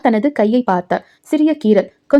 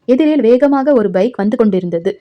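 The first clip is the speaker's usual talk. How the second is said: ordinary delivery, nothing marked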